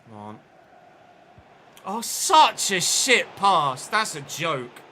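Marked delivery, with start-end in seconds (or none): 1.8-4.8 loud, slow, disgusted groan at the pass; furious outburst. Real, not acted. Gamer raging at a terrible pass from his player, loud and slow. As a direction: drag it out in real disgust.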